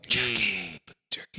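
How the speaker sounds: fading echo of the last word; canyon echo. Real, not acted. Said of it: "Sorry about the echo tonight".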